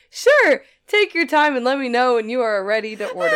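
bright fake-smile service voice, high on Sure; chirpy polite clerk with a fake smile; customer service voice. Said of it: "my best fake smile and customer service voice".

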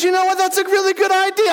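high-pitched, eager agreement; keen pig. Acted. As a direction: play a pig sold on the idea. Voicing the pig agreeing with chicken.

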